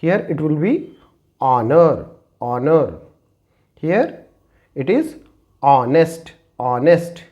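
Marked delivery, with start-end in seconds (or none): 1.4-2.9 "honor" said as "onner", no H sound; "honor" starts straight on the vowel; silent h. Shown as a demonstration. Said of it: "When H is silent". 5.6-7.2 "honest" said as "onest", no H sound; "honest" starts on the vowel, H dropped; silent h. Shown as a demonstration. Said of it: "When H is silent".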